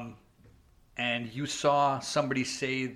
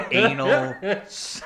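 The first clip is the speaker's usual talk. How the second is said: laughing; 14 semitones higher, 12 dB louder, slower than usual. Real, not acted. Laughing at own punchline.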